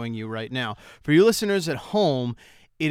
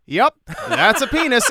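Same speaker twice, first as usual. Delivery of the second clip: slow, mock-casual, higher and louder with a laugh; deadpan museum visitor; 10 semitones higher, 7 dB louder than usual. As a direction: shrug it off like a bored visitor. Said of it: "stand next to it and go".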